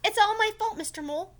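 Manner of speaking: high, loud mock-sad cry; sadly. Franklin confessing to Mister Mole, high and loud. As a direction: wail it like a sorry little kid. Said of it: "Franklin said sadly".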